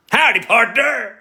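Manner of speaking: high, loud mock American cowboy; American accent. Streamer answering critics of the accent, loud mock American. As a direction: defiantly holler a hammed-up American howdy. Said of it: "say my accent's not good now".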